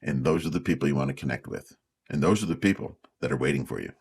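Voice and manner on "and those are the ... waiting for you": ordinary delivery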